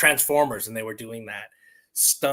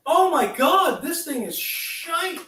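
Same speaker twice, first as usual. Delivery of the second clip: high, stretched-out disbelief, slow; dismayed squeal; 5 dB louder, slower than usual. Real, not acted. Vlogger missing his first shot, high dismayed wail.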